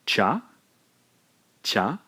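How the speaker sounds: "cha" as one syllable; one clean beat for "cha"; blended cha. Shown as a demonstration. Teacher showing learners the blended syllable. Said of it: "we end up with".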